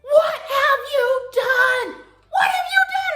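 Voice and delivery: very high, loud, drawn-out scream; horrified mother's shriek. Retold or imitated. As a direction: screech it like an appalled mom. Mother runs out, confronting daughter.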